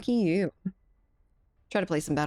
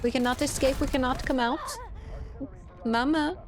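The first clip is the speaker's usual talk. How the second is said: higher, wailing prisoner voice; hammed-up cry of the trapped; mock trapped prisoner; 9 semitones higher than usual. Joking that Louisiana is a prison, higher hammed-up wail.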